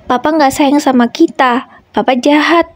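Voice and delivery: lightly voiced daughter crying out at her father; crying child. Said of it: "sembari menangis semakin keras". (tangisan anak kecil, suara lebih tinggi)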